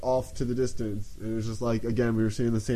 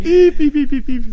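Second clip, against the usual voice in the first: very high, loud alien beeps; alien beeping; 13 dB louder than usual. Pretend. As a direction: beep like a tiny spaceship. Alien noises were coming out of it.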